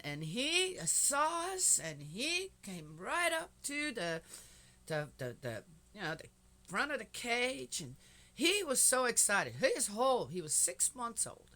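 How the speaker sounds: high, loud excited telling. Real, not acted. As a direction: gush about the wiggling puppy. Excited retelling of puppy.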